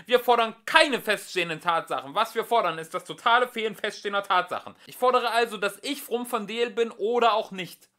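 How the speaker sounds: high, loud breathless yell; shouting. A narrator's reading. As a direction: rattle it off yelling.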